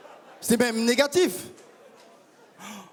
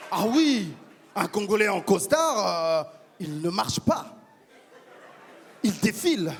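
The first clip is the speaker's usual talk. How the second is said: proud Congolese man. Acted. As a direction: play a pompous Congolese gent musing on love. Congolese persona on stage.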